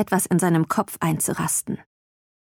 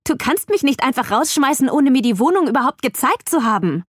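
higher, heated objection; indignant protest; 5 semitones higher than usual. A narrator's reading. Visitor protests being thrown out unseen, indignant. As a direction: object hotly to being dismissed.

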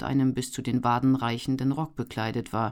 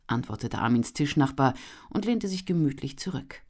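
usual speaking voice

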